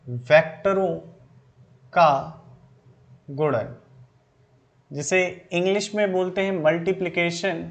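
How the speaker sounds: measured dictation pace with long pauses between phrases. Acted. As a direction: spell it out phrase by phrase so students can write. Dictates notes to students slowly.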